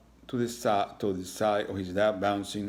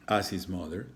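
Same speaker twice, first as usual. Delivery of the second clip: ordinary delivery, nothing marked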